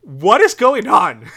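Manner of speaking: slow, high, loud cry of confusion; loud bewilderment. Real, not acted. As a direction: burst out utterly baffled, stretching the words. Bewildered by the pig nose reveal.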